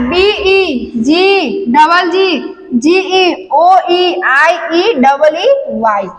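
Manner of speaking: letters spelled out slowly one by one; slow, deliberate letter-by-letter spelling. Shown as a demonstration. Teacher slowly spells out letters to class, slow and deliberate. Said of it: "वहाँ पे क्या बोला है?".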